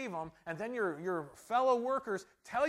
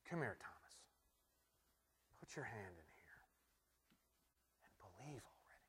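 hushed intimate plea; 9 semitones lower, 19 dB quieter than usual. Acted. Jesus voiced intimately to Thomas.

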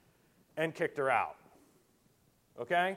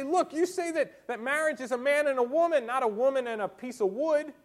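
high, loud indignant protest; shouting foul; 8 semitones higher, 5 dB louder than usual. Acted. Preacher acting an unbeliever calling out hypocrisy, loud protest. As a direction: cry out the objection as an outraged unbeliever. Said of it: "I would shout foul here".

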